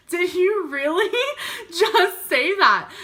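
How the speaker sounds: high, slow, laughing through the words; can't believe he said it, laughing; laughing disbelief. Retold or imitated.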